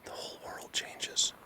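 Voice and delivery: slow, voiceless whisper in awe; awed whisper. Real, not acted. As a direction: breathe it out, stunned. Hushed reveal in the woods.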